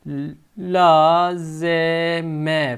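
very slow "lazeme" letter by letter; drawn-out root "lazeme"; slow spelling. Shown as a demonstration. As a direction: dictate it slowly while writing. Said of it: "Kelimenin kökü ne çıkacak".

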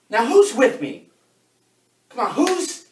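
high, loud call to the troops; rallying cry. Acted. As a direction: whip the room up, urging them to join. Rallying the team to join in.

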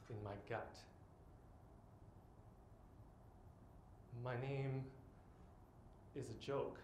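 hushed, sinking poetic confession; hurt and ashamed. Acted. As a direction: say it softly, with long pauses, as if shamed. Poem turns to hurt and shame.

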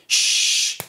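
unvoiced shush for the beat; quick "shh" hiss; sh sound. Shown as a demonstration. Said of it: "So starting like this".

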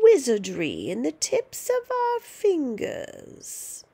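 put-on high, slow, gloating old witch; menacing witch grandma. Grandma scaring George with witchcraft, gloating witchy voice. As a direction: savour every threat.

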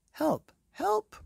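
high, loud panicked page; frantic cry for help. Acted. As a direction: shout it in alarm like a frightened page. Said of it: "cried the page".

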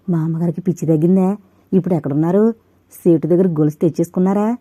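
ordinary delivery

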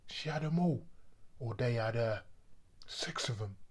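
normal talking voice

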